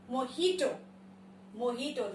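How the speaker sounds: "mojito" as mo-HEE-to; correct pronunciation. Shown as a demonstration. Teacher modelling the third word, correctly pronounced. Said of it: "it is mojito".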